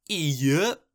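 put-on stallion drawl, not truly deep; stallion Applejack. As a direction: imitate a deep-voiced stallion, half-heartedly. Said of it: "we hear Applejack in deep voice go".

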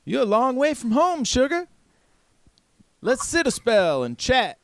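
very high, folksy woman; woman's character voice. Acted. Woman greeting strangers far from home, high folksy voice. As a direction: greet travellers in a high put-on voice. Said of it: "And she's like".

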